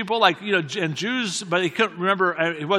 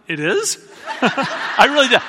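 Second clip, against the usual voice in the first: laughing, higher and louder; laughing disbelief; 6 semitones higher, 5 dB louder, slower than usual. Real, not acted. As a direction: blurt it out through laughter. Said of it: "I think I said out loud".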